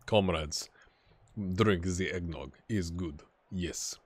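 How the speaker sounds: low, slow comrade with a put-on Russian accent; mock Russian accent. Vlogger toasting his viewers, in a low mock Russian accent. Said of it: "the first episode with the accent".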